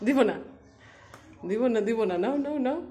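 high, loud sing-song refusal to a pet; teasing pet talk. Real, not acted. Owner refusing to give Koko the toy, high loud teasing pet voice. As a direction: taunt the dog playfully, high and loud.

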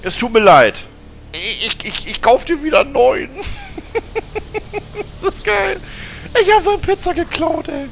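high, laughing fake apology; giggly mock apology. Real, not acted. Gamer giggling a fake sorry to the coworker. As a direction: apologize while barely holding in laughter.

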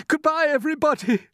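very high-pitched desperate goodbye; gasped farewell. A narrator's reading. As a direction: cry a last goodbye in panic. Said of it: "gasped James".

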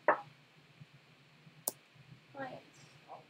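barely audible, broken-up anger; real anger, hushed almost to nothing; quietly mad. Real, not acted. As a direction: breathe it out very softly, word by word.

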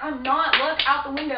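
loud, pleading half-shout; urgent insistence. Retold or imitated. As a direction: insist they look now. Insisting to siblings, scared.

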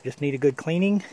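ordinary talk

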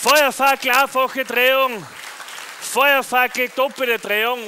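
loud, high announcer call; showman announcing. Acted. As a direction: call out the trick like a ringmaster. Announcing fire-torch tricks.

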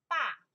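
爸 said with a sharp falling fourth tone. Shown as a demonstration. Teacher drilling the class on the falling tone. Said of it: "爸, the four tones".

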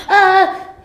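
high, loud sudden scream with laugh; startled scream. Real, not acted. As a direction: shriek in fright. Scream, loud, timbre changed.

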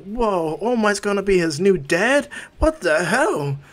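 high, loud, incredulous outburst; shocked disbelief. Acted. Students learn All Might is his new dad, loud shock. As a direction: react stunned, stretching the last words.

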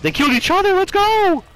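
high, loud cheering; excited cheer. Real, not acted. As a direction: cheer with pure hype. Celebrating lucky double kill.

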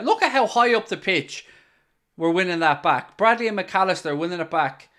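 urgent, loud burst of match commentary; excited commentary. Real, not acted. Football fan calls the team winning it back, excited.

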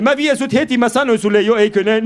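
a touch of an offended cabbie; irritated driver. Retold or imitated. Driver snaps back in story.

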